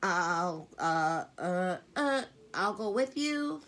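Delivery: put-on frightened girl forcing out the offer; shrill. A narrator's reading. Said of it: "Meg's voice said in a shrill".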